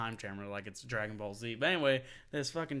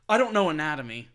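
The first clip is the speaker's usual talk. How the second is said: loud mock quote; 10 dB louder than usual. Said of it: "That's a little inside joke".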